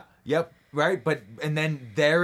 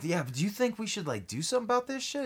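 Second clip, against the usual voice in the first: nervous henchman; 6 semitones higher, 5 dB quieter, faster than usual. Retold or imitated. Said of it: "they sat there like".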